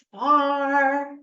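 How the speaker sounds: high, loud, stretched-out "far"; calling "far". Shown as a demonstration. Teacher showing far with a scarf, calling out. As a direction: send "far" into the distance.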